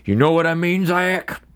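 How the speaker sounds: man at the bar asking his buddy; drunk buddy voice. Retold or imitated. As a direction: lean in like a tipsy friend. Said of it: "he's like".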